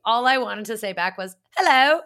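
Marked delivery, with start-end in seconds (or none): 1.6-2.0 high, loud mock-British hello; British accent. Host eagerly parroting the guest's greeting back in a British accent. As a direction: echo a British greeting back, much louder and higher. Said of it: "respond to them in a British accent".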